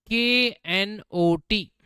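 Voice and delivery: letters spelled one by one slowly; slow spelling. Shown as a demonstration. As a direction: dictate each letter slowly. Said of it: "जैसे मैंने आपसे बोल दिया". (अक्षर धीरे धीरे बोलना)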